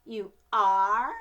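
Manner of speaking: loud astonished gasp of a question; can't believe it; stunned schoolgirl; amazed question. A narrator's reading. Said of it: "said Victoria".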